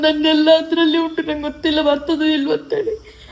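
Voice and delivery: real nervous laugh close to tears; laugh-cry about the lost bag; laughing through tears. Real, not acted. Worried her bag won't arrive.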